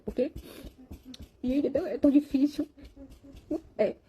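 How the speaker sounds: fighting back tears while telling it; crying. Real, not acted. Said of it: "Tá chorando por quê?".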